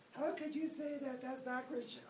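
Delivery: high, squeaky voice of an indignant critic; shrill, mocking pious objection; mock pious objector. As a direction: play the scandalized churchgoer in a high voice. Imagined pious objector protests.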